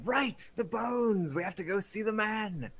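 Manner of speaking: high, loud and slow with excitement; re-excited. Acted. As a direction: announce it with sudden excitement. In-character excited declaration.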